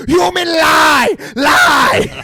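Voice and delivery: loud, high, drawn-out furious yell; full-force hammed-up roar, each word stretched; Koba. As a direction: bellow it as an over-the-top ape accusation. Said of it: "And Koba yells".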